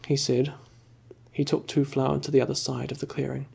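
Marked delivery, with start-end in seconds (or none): none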